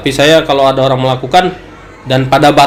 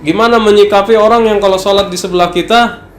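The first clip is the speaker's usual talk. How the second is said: higher-pitched mock grievance; imitated complaining; 6 semitones higher than usual. Worshipper complaining to the ustadz about a neighbour's finger, raised-pitch grumble. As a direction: play a bothered worshipper bringing a complaint. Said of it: "kadang orang suka ngadu".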